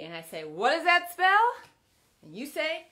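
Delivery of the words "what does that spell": loud, high cheerleader call; cheer. Shown as a demonstration. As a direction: call it out like a pep rally. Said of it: "we're gonna cheer this word out".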